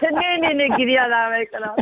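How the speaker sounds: amused protest through real laughter; laughing denial. Real, not acted. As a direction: laugh it off, high-pitched. Guest laughs off teasing about crying.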